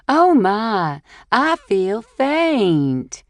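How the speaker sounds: put-on overly dramatic Southern belle accent; fake Southern accent. A narrator's reading. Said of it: "in a fake Southern accent".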